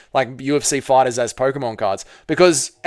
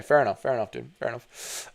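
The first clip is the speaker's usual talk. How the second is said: each repeat softer until whispered; fading to whisper; 5 semitones lower, 11 dB quieter than usual. Real, not acted. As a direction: let it trail off into a whisper. Repeats phrase, fading away.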